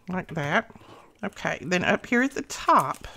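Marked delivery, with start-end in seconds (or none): none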